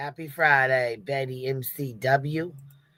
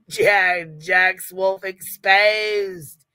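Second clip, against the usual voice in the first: mock British accent; 3 semitones higher, 4 dB louder, much slower than usual. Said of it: "my accent".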